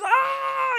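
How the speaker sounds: very high, loud comic shriek; hammed-up scream of terror; mock panicked scream. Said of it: "the guys are flipping out".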